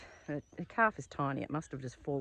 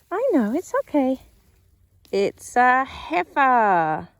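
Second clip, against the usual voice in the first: much higher, louder, slow cooing; 8 semitones higher, 12 dB louder, slower than usual. Real, not acted. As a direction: soothe the calf while checking it. Soothing calf while checking.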